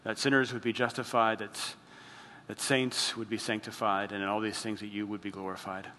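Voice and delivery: soft, low-key closing prayer; hushed pastor's prayer voice. Acted. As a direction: pray it gently to God. Closing prayer before sermon.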